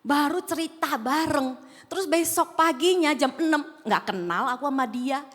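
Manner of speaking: normal talking voice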